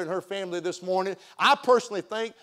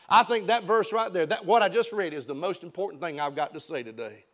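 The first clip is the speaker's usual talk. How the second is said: usual speaking voice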